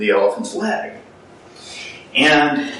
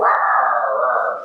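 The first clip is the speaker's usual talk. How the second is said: playful dinosaur roar. Retold or imitated. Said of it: "and I'll go".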